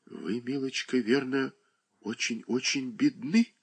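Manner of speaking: soft and tender question; a kind mistress pitying a poor girl; gentle, kind. A narrator's reading. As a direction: ask kindly and softly. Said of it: "спросила ласковым тоном Шершнёва".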